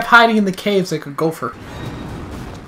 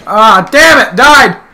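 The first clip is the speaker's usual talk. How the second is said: louder, higher frustrated no; frustrated shouting; 5 semitones higher, 4 dB louder, slower than usual. Real, not acted. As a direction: yell as you lose your stuff. Dying in game.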